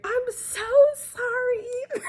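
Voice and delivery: high, drawn-out tearful whine; mock crying. Recapper voicing the woman apologizing to Ethan, mock crying. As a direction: imitate a sobbing apology, hammed up. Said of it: "And she starts crying".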